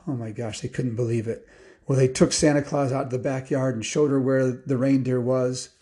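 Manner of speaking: ordinary delivery, nothing marked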